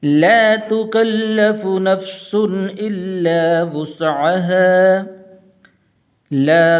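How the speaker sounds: full tajweed recitation with long held vowels; Quran recitation. Acted. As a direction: recite the whole passage as a flowing chant. Full recitation after the lesson.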